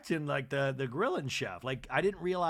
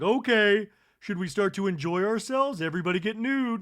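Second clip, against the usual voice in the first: loud, higher hostess call; rally the guests; mock Kathy; 6 semitones higher, 9 dB louder than usual. Imagined Kathy rallying the guests, loud. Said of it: "Kathy's like".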